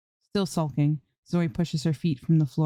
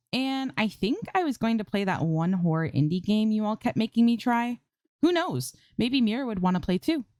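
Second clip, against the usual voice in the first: scheming tease; 7 semitones higher than usual. Acted. Teasing chat like a supervillain.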